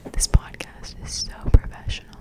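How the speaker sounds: hushed podcast whisper. Real, not acted. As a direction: whisper so no one hears. Whisper after interruption.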